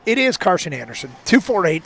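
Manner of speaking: normal talking voice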